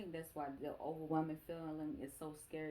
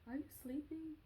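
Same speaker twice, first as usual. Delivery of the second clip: put-on high, soft child's voice; little daughter; 9 semitones higher, 5 dB quieter than usual. Young daughter checking on mom, high and soft. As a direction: ask sweetly in a high, small voice. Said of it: "She like".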